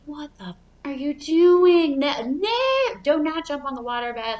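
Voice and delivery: mom's yell, high and loud on no, no; bursting in to scold the kids; imitating angry mom. Mom catching her kids jumping on the waterbed, yelling. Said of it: "like, pissed at us".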